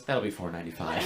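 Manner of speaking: bored cashier, mock flat; unimpressed French clerk in English. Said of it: "She just goes".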